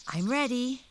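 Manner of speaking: put-on eager girl's voice; Dorothy's voice. A narrator's reading. Dorothy answers the tiny man.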